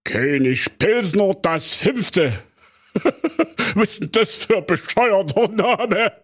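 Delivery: commissioner mocking the king's name, laughing; laughing cop.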